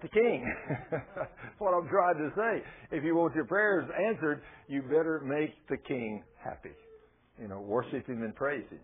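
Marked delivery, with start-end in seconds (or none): none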